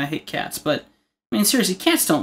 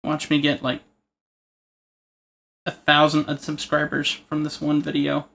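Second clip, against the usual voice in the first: ordinary delivery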